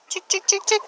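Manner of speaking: high sing-song chick chick call; calling chickens. Real, not acted. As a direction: call the hens over for food. Mom calls chickens to feed.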